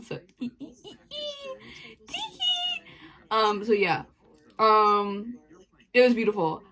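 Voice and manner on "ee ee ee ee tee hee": tiny high, soft tee-hee; dainty high-pitched giggle imitation; imitated giggle. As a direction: squeak out a mock giggle very softly. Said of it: "and they giggled".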